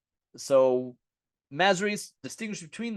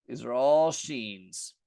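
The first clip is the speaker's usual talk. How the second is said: ordinary talk, nothing marked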